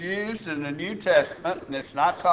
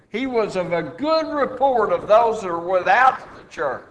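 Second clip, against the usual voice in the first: higher, louder pulpit testimony; forceful preaching; 6 semitones higher, 6 dB louder than usual. Real, not acted. Preacher testifying to a holy elder's good name, forceful preaching.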